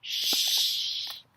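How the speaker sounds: playful shushing sound; hushing shh. Pretend. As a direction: hush them so the bear sleeps. Hushing so polar bear sleeps.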